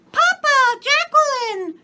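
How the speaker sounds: put-on very high, loud child voice; little girl's voice. A narrator's reading. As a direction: squeal like a small girl. Small girl calling her father.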